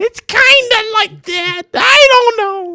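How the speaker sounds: very high, slow, loud cartoon voice; exaggerated high-pitched movie character impression; Whitey. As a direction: stretch the words out in a squeaky falsetto. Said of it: "that's from, um, the Adam Sandler movie, Whitey".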